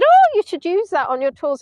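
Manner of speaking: high, loud opening burst; enthusiastic sister. Retold or imitated. Sister suggesting material for the tours, enthusiastic. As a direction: burst out with a bright idea. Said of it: "She said".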